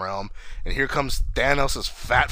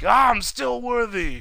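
high, drawn-out triumphant cry; Thor; 12 semitones higher, slower than usual. Retold or imitated. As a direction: crow it slowly in mock triumph. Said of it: "he was like".

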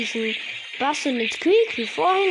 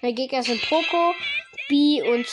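normal talking voice

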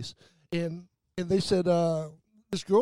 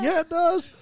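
higher, louder smug comeback; cocky retort; 11 semitones higher, 5 dB louder than usual. Retold or imitated. The speaker defying the staff, smug mock retort. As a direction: toss it back like a cheeky brat. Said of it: "I was like".

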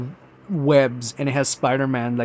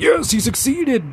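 cheering for Thanos; 10 semitones higher, 3 dB louder than usual. Retold or imitated. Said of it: "I did cheer for Thanos because I'm like".

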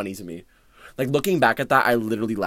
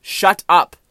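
louder retold shut-up, pitched up; playful louder shout; mock yell; 10 semitones higher, 9 dB louder, much slower than usual. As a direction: snap it back at a friend, loud. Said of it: "I'm like".

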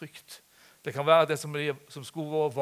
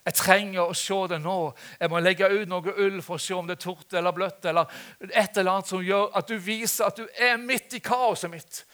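impassioned plea, building louder and higher; raised-voice demonstration prayer, pressing on; crying out to God; 6 semitones higher, 8 dB louder than usual. Acted. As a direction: call on God with mounting urgency. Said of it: "Vi må rope på Gud".

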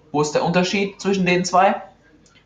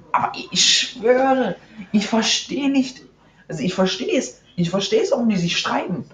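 high exasperated; 7 semitones higher than usual. Real, not acted. Exasperated rant about the two.

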